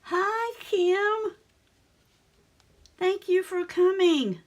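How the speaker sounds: host greeting a viewer who joined, delighted and high; bright high greeting. Real, not acted. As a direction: sing out a welcome to a friend. Greeting a viewer in live chat.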